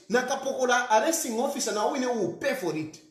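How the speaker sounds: ordinary delivery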